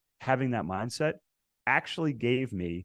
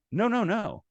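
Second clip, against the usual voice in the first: high, louder triple no; emphatic refusal; 13 semitones higher, 3 dB louder than usual. Retold or imitated. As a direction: shut the idea down with a jump in pitch. Refusing boss's lower quota.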